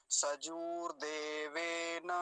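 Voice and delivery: सजूर देवेन very slowly, long oo in सजूर; slow teaching model of सजूर देवेन; careful long vowels. Shown as a demonstration. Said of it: "बड़े ऊ की मात्रा को ध्यान से बोलेंगे".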